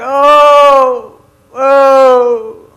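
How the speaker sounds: long, high moans of pain; loud mock groaning. Wife hurting at four in the morning, loud mock groaning. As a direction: moan loudly, drawing it out.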